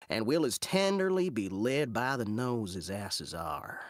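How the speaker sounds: put-on scheme aloud in an awful Southern accent; terrible Southern accent. Villain works out a plot against the Moor, terrible Southern accent. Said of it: "doing a terrible Southern accent".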